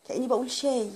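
usual speaking voice

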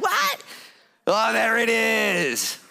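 high startled "What?" then slow drawn-out realization; mock surprise. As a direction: play it up like a caught-out sinner. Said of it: "It's like".

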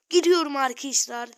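loud cry as he goes down; panicked yell. Real, not acted. Telling viewers he is dying, loud panicked cry. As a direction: yell it out in alarm.

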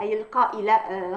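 ordinary delivery

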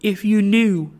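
earnest Trotty. A narrator's reading. Penitent Trotty appeals to the bells, earnest. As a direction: plead earnestly, voice lifted. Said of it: "clasping his hands earnestly".

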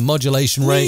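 normal talking voice

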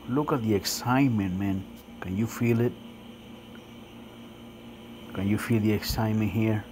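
normal talking voice

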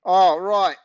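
high, loud reply; Dad shouting back up, high loud reply; shouted back. Acted. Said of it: "Dad shouted back".

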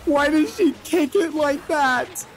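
ordinary talk, nothing marked

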